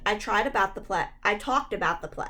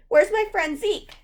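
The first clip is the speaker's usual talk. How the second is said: much higher, loud and scared; shrill frightened demand; 11 semitones higher, 4 dB louder than usual. A narrator's reading. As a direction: demand it in a panicky voice. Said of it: "I demanded in a shrill, frightened voice".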